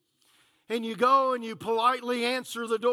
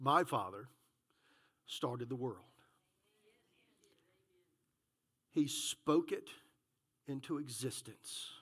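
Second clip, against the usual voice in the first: solemn preaching; 11 semitones lower, 13 dB quieter than usual. Acted. Preacher quietly reminding the church they are heirs, hushed. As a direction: let it settle, hushed and reverent.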